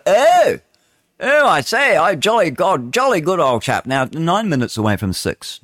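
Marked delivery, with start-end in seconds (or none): 0.1-3.9 high hammed-up posh English "jolly good old chap"; posh English accent. Radio host mocking his own English roots in a posh English accent. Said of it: "I go".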